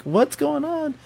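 very high, drawn-out question without loudness; baffled alarm. Retold or imitated. Dog owner finds blood, high baffled alarm.

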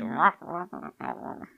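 growling beast noises while eating; dinosaur voice. Shown as a demonstration. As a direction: growl like a ravenous animal. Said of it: "That's how I sound when I eat".

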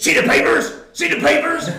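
building from flat to a high, loud yelp; excited boast. Retold or imitated. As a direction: burst in crowing about the papers. Teammate bursts in, showing off.

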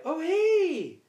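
high, loud surprised greeting; startled hey. Retold or imitated. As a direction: squeak it out caught off guard.

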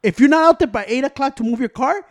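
heated loud explaining. Real, not acted. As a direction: keep yelling the point. Yelling at friend in argument.

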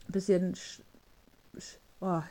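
catching in the throat; words stuck on a frog in throat; something in throat. Real, not acted. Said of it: "ich hab was im Hals".

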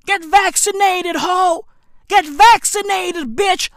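high-pitched mock yell imitating Blac Chyna; mock scream. Imitating her screaming at the airport, high mock yell. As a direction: shriek it like a woman losing it at the airport. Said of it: "She was out there screaming".

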